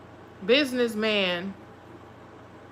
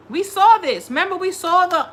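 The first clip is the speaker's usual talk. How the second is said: high, loud and triumphant; told-you-so outburst; 7 semitones higher, 4 dB louder than usual. Real, not acted. To Marino about the catfish, triumphant outburst. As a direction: crow that you called it.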